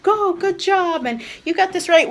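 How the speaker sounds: put-on dad cheering the toddler on, over the top; exaggerated praise. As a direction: hype up a little kid. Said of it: "exaggerating when he".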